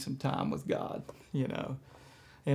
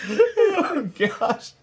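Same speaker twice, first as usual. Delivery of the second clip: laughing; 13 semitones higher, 9 dB louder than usual. Real, not acted. Laughing recovery.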